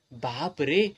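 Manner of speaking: ordinary delivery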